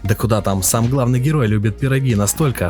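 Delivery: ordinary talk, nothing marked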